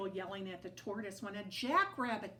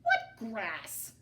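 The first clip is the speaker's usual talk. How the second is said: cowboy voice; 6 dB louder than usual. A narrator's reading.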